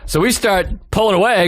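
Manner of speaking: normal talking voice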